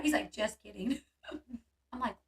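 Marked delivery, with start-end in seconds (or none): 0.3-1.0 dropped, casual retold punchline; offhand prank reveal. Sean to scared partner after his prank, offhand. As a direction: let the panic fall away, low and easy.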